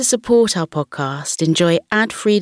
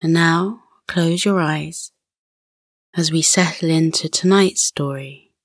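guided relaxation. Acted. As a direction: gently settle a child to sleep.

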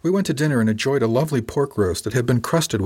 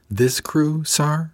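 higher, mock-innocent surprise; lightly incredulous question, pitched up; taken aback; 6 semitones higher, much slower than usual. A narrator's reading. Crew member questions the worry about the crew, taken aback. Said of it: "as if taken aback by the very idea".

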